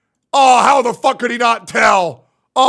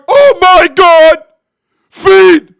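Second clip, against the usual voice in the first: loud, high, drawn-out mock yell; high-pitched, over-the-top shouting; mock freak-out; 7 semitones higher, 10 dB louder, much slower than usual. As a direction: freak out loudly in exaggerated awe. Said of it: "Why isn't he going".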